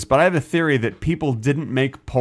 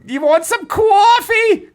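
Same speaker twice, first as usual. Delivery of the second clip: very high, slow and louder sitcom housewife; Edith Bunker; 5 dB louder, much slower than usual. Retold or imitated. Co-host jokingly plays the sitcom wife offering coffee, very high and slow. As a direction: stretch it out like a fussy TV wife. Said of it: "Archie Bunker's wife".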